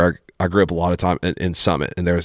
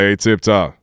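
light imitation of a coach; Coach Moore. Host repeats the coach's greeting to him, light impression. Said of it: "this is exactly how he sounded".

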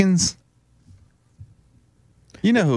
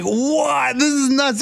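surprised; 10 semitones higher, slower than usual. Retold or imitated. Shocked at real voice.

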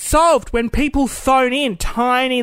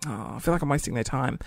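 sheepish quiet voice; 11 semitones lower, 10 dB quieter than usual. Retold or imitated. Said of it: "I was like".

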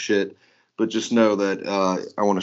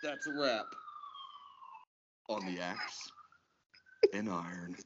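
hushed sign-off; 2 semitones lower, 18 dB quieter than usual. Pretend. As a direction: drop to a near whisper with pauses between phrases. Sign-off dropping before shouted last word.

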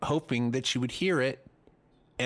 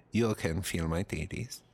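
lower-pitched mock Spanish accent; Penelope Cruz; 5 semitones lower than usual. Imagined star propositioning the comic, Spanish accent. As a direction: coax in a put-on Spanish accent. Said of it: "That's my Spanish accent".

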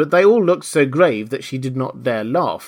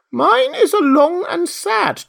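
very high, slow mouse voice; sighing mouse; 6 dB louder, slower than usual. A narrator's reading. Mouse beginning its history, mournful squeak. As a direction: lament slowly in a high, loud squeak. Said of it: "turning to Alice and sighing".

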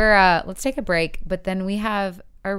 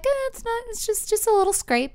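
much higher, breezy wife brushing it off; wife's voice. Retold or imitated. Comedian's wife downplaying the car scrape, high breezy. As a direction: play down the damage, lightly dismissive. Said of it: "his wife's like".

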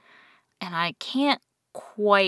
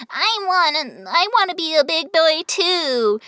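much higher, mock little-boy whine; big-boy wannabe caricature; 11 semitones higher, 3 dB louder than usual. Mocking how the character could be written, high childish caricature. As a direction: put on a pitched-up childish caricature voice. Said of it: "could be a caricature of".

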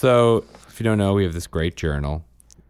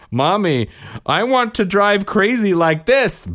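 put-on high, louder squeaky child; high little-girl voice; 5 dB louder than usual. Mom quoting daughter's answer, high excited child voice. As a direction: sound like an excited toddler.

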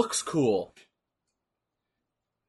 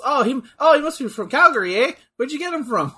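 higher mock Canadian voice; imagined Canadian local; 7 semitones higher than usual. Local spots the cowboy boots, high mock Canadian voice. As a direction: lightly imitate a curious Canadian local.

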